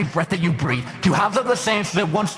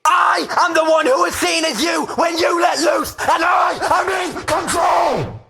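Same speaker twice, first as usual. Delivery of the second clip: slow declarations swelling into a high, loud shout; building menace to shouting; 8 semitones higher, 4 dB louder, slower than usual. Acted. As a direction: start measured, escalate line by line to a full-throated shout. Inner demon declares itself, building in rage.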